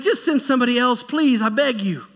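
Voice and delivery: begging Moses. Acted. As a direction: beg with everything. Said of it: "so he's like".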